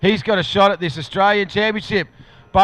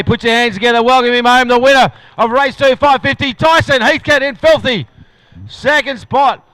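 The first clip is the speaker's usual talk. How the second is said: louder and higher, calling out the winner's name; crowd announcement; 4 semitones higher, 6 dB louder than usual. Real, not acted. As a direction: rouse the crowd to cheer the champion.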